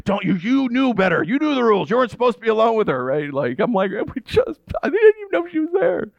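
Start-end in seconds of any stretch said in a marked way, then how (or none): 0.1-3.0 higher-pitched, hurried telling-off; mock scolding. Youth leader scolding boy for being with girlfriend, mock scolding. 3.9-6.1 very high-pitched mock indignant whine; hammed-up teenage protest of innocence; pleading protest. Boy defending himself to leaders, pleading protest. Boy's exasperated protest retold.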